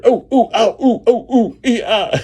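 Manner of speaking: high, imitated ow and ooh cries while tumbling; pained yelps. Hank sliding down into the woods, high yelping cries. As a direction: mimic a man bumping into trees as he slides.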